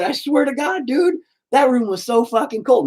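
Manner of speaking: high, animated pleading excuse; excited excuse. Retold or imitated. Swearing to the nurse the room was cold, high and animated. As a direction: protest it to the nurse, wound up.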